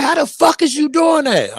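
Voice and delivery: high, shocked squeal of disbelief; stunned disbelief. Retold or imitated. Friend can't believe he hears thoughts, shocked high squeal. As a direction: voice shooting up in amazement.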